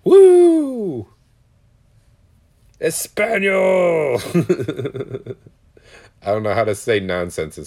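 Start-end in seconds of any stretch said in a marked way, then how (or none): 0.0-4.2 very high, loud party holler; whoop, then draw the word out long; rowdy whooping. Retold or imitated. Re-enacts own rowdy whooping reply. 4.2-5.3 amused chuckle at own joke; short burst of giggling; real laugh. Real, not acted.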